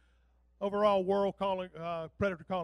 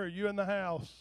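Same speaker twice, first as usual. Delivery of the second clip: ordinary talk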